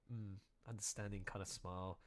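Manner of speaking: ordinary delivery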